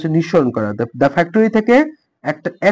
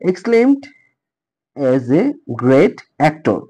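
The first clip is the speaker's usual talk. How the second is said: slow example reading; much slower than usual. Shown as a demonstration. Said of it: "উদাহরণ আছে এখানে যে".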